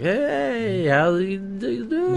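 put-on high, slow, slurred drunken greeting. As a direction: sing it out like you're tipsy. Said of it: "acting a lot more drunk and like".

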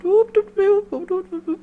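very high warbling alien chirp; high-pitched warbling voice. Retold or imitated. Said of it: "speaks actually in this high-pitched warbling voice".

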